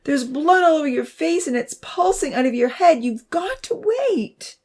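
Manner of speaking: high, distraught urging rising to a wail; frantic high plea; crying out. A narrator's reading. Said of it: "Abby cried".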